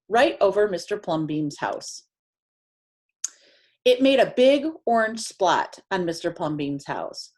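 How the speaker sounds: usual speaking voice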